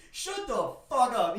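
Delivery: loud, slow, furious retold yell; hammed-up shouted comeback; 1 angry yell. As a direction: bite it out at full force. Retelling yelling at the guy.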